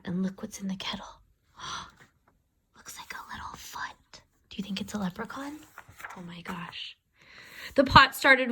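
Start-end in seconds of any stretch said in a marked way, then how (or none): 0.0-7.0 breathy hush of suspense; soft suspense whisper. A narrator's reading. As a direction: whisper it like a secret. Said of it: "hushed suspense to children".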